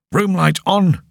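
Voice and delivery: sudden loud barked command; shouted order. A narrator's reading. Officer bursting in, shouting an order. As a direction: bark it at the dark room. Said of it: "came the order".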